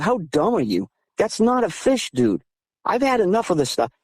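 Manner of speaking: high-pitched exasperation, no real shout; retold yelling. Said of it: "And I'm yelling at him".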